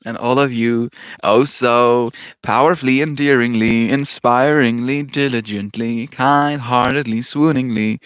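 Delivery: loud, bubbly over-the-top reading; shower them with sugary praise, slowly in spots; gushing reading. Acted. Reads loving stream message.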